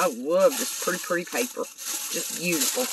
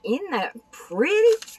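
high, slow, delighted gush; drawn-out admiring squeal; 10 semitones higher, 4 dB louder than usual. Real, not acted. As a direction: coo over the pretty card. Gushing over handmade card.

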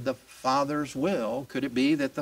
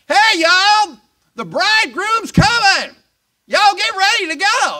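much higher, louder hammed-up Southern accent call; Southern accent shout; 10 dB louder than usual. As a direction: yell it out like a Southern wedding crier, Southern accent all through.

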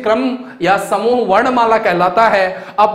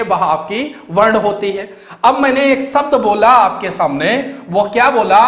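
ordinary talk, nothing marked

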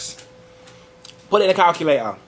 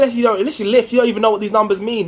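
ordinary talk

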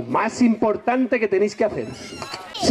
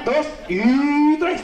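drawn-out count; 5 semitones higher, much slower than usual. Acted. Slow dramatic countdown for warmup.

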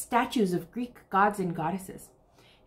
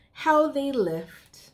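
put-on reading to children; 5 semitones higher than usual. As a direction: read it like a bedtime picture book for little ones. Reads rhyming picture book aloud.

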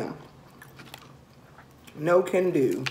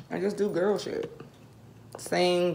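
usual speaking voice

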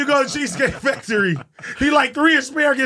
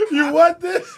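dad's threat mocked; 8 semitones higher, 5 dB louder, slower than usual. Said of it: "My dad be like".

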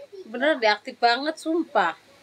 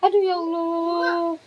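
pained groan; 7 semitones higher, slower than usual. Real, not acted. Uncomfortable from strong kicks.

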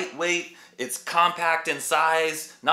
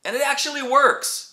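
much higher, insistent "works"; enthusiastic, convinced reviewer; excited emphasis; 10 semitones higher than usual. Real, not acted. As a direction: really sell that it works. Repeats claim with emphasis.

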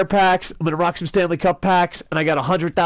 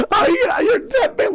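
high, slow fake sob, half joking; mock crying; slower than usual. Streamer hams up tears over his losing team, slow and high. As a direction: wail like he's about to cry on air. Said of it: "not to cry on air".

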